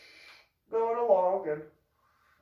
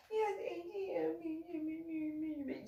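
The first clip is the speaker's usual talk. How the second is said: tiny high voice, slow and soft; squeaky, cowed imitation; mocking meek reply; 13 semitones higher, 10 dB quieter, much slower than usual. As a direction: drag it out in a small mock whine.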